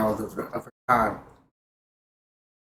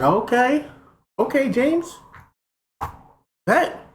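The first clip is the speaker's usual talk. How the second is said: sarcastic; 13 semitones higher, 3 dB louder, slower than usual. Retold or imitated. Doubting James's denial.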